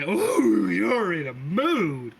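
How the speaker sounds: high, loud swooping "ooh", then drawn out; hammed-up, high-pitched mom voice; cooing mother. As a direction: coo it slowly like a teasing mom. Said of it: "You just hear your mom say".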